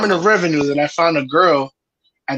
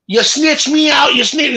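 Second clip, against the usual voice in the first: put-on high-pitched Jamaican accent, slow; imitating a Jamaican woman; 7 semitones higher than usual. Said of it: "with her thick-ass Jamaican accent".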